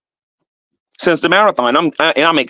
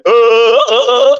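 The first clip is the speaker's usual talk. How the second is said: mock caveman grunting, higher and louder; Neanderthal grunts; 7 semitones higher, 4 dB louder, slower than usual. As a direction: grunt like a hammed-up Tarzan. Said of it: "like a Neanderthal, like Tarzan".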